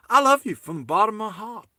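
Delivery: hammed-up Texan declaration of love; mock Texan accent. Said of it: "That sounds more Texan".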